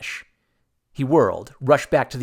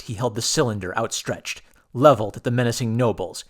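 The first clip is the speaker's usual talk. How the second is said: usual speaking voice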